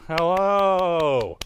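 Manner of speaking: ordinary talk, nothing marked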